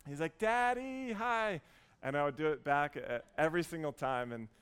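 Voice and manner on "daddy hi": put-on high, louder kid's call; mock holler from afar; imitated child yelling. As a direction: call out like a little boy across a pool. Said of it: "he's yelling over everyone".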